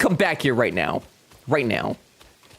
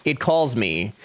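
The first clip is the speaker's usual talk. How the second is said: usual speaking voice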